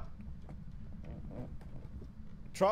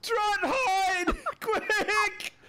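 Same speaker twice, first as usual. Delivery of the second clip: panicked shouting; 12 semitones higher, slower than usual. Real, not acted. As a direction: scream at a friend to run. Panicked shouts during ghost hunt.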